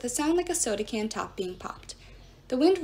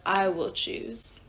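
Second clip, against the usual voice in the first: hollow possessed voice; 2 semitones lower, much slower than usual. A narrator's reading. Possessed Jason answers, slow and hollow. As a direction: speak as something inside him. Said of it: "in the same hollow voice".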